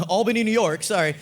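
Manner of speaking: usual speaking voice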